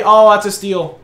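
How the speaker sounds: ordinary talk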